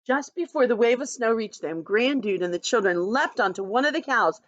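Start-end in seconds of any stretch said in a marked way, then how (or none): none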